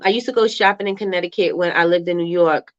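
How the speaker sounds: ordinary talk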